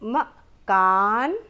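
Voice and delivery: मकान stretched out slowly and clearly; slow model reading. Shown as a demonstration. Teacher modelling the first option, drawn out. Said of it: "पढ़कर उच्चारित करेंगे।".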